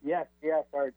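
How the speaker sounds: ordinary delivery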